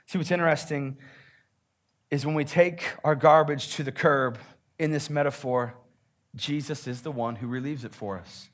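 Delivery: ordinary delivery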